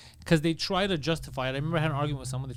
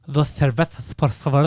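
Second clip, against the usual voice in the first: Castilian Spanish lisp, th for every s and z; mock Castilian accent, louder; a lisping Castilian ordering beer; 3 semitones lower, 6 dB louder, slower than usual. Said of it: "He's like".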